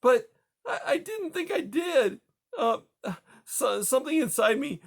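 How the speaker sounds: high, cracking tearful confession. Acted. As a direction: confess it while fighting back sobs. Grieving widow confesses doubt.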